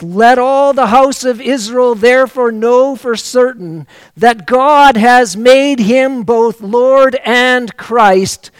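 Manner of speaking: loud, slow proclamation at the climax; full-force preaching. Acted. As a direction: thunder it to the whole house of Israel. Climax of Peter's sermon.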